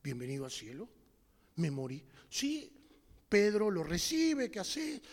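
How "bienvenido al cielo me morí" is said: much lower, softer repeat of the welcome; hushed, stunned. Acted. Dead man repeating heaven's welcome, low and stunned. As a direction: let it sink in, low and faint. Echoed welcome, realizing death.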